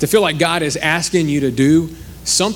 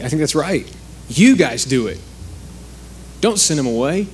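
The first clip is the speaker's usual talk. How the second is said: ordinary talk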